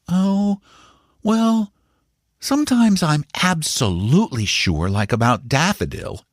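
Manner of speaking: put-on high, eager young boy's voice; a boy boasting to his uncle; boy Paul. A narrator's reading. Young Paul assures his uncle and Bassett, eager and high.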